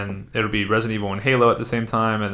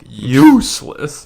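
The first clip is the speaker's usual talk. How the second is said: exasperated; 4 semitones higher, 8 dB louder, much slower than usual. Real, not acted. Exasperated at the game heroine.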